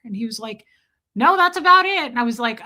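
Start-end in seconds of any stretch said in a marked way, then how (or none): 1.2-2.1 loud, high, brisk brush-off; retold dismissal. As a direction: shut the request down as dad. Acted dad's curt refusal.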